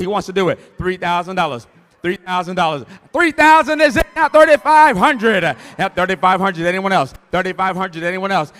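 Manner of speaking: loud, rhythmic auctioneer calling out bids. Acted. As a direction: drive the bidding like an auctioneer.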